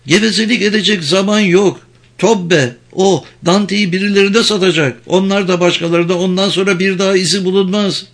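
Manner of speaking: high, pressing woman's voice; high-pitched urgent warning; frantic Vicky, urgent. A narrator's reading. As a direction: urge her on in a high, desperate voice.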